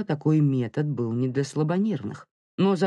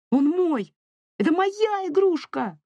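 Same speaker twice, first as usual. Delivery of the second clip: put-on very high child's protest; defiant high-pitched kid voice; stubborn little boy; 3 dB louder than usual. A narrator's reading. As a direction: squeal it like a possessive small child. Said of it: "С удвоенным упрямством принялся защищаться Мило".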